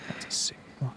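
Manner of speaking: whispered aside, no voice; hushed admiring whisper. Real, not acted. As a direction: breathe it as a secret compliment. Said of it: "whispered aside".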